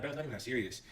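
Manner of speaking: soft, low, unbothered; calm and collected. Retold or imitated. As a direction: brush it off calmly. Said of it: "real calm and collected".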